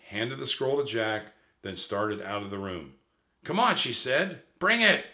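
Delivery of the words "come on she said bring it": put-on urgent little girl. A narrator's reading. Annie urging Jack.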